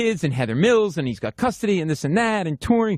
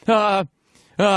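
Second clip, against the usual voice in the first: halting, higher "uh" with a gap; stammering; 5 semitones higher, 4 dB louder than usual. Retold or imitated. Fan asked how to do it, mimicked stammer. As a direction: mimic yourself stuck for words. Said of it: "like, stammering".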